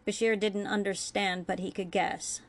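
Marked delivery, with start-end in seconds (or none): none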